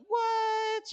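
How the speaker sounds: high squeal of disbelief; shocked squeal. Retold or imitated. Pregnant girl hearing the news, shrieking high in shock.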